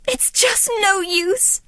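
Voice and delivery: mild tearful sob; sobbing. Acted. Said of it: "Cinderella sobbed".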